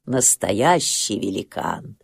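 plain reading voice

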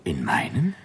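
slow, breathless whispered question; stunned whisper. Acted. Clerk hears of his promotion from his boss, stunned whisper. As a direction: barely dare to ask it.

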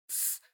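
soft voiceless shush; hushing hiss. A narrator's reading. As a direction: shush them quietly. Hushing the others.

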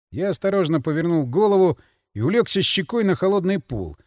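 usual speaking voice

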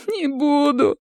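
very high, pitiful moan. A narrator's reading. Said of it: "простонал Женя". (жалобный, высокий стон)

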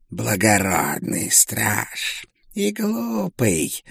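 slow, eerie spirit voice; a mocking dead soul; ghostly voice. A narrator's reading. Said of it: "сказала душа". (медленный потусторонний голос)

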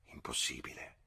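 low, soft, near-whispered word; murmured. A narrator's reading. Lucy answers George's theory, murmuring. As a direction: murmur it under your breath. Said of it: "mormorò Lucy".

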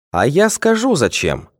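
lightly voiced mock pity, sneering underneath; mocking sympathy. A narrator's reading. Official taunting a guilty schoolboy with mock sympathy. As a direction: fake concern with a sneer. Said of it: "с каким-то глумливым сочувствием".

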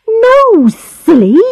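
put-on high, exasperated girl's cry; cried out. A narrator's reading. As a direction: cry out impatiently in a high girlish voice. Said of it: "she cried".

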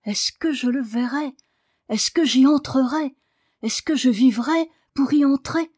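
high, mounting urgent questions; overwrought. A narrator's reading. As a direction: plead with rising emotion. Daniel overwhelmed, begs to see garden.

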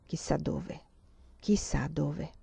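wistful murmur dying out; fading wistful murmur. A narrator's reading. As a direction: let it fade away like a sigh. Emanuela's wistful thought, fading.

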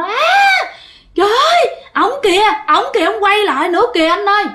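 very high, frantic, rushed screaming; high panicked cry, fast; terrified scream. Retold or imitated. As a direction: shriek it like a terrified little kid seeing a ghost. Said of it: "nó gào lên".

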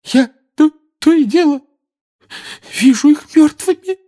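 very high, loud tearful crying; bitter crying complaint. Acted. Mrs Weasley crying on Lupin's shoulder, bitterly sobbing. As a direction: sob out the words bitterly. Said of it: "горько жаловалась миссис Уизли".

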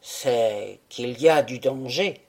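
low, grave statement of danger; a husband reluctantly admitting the risk; grave admission. A narrator's reading.

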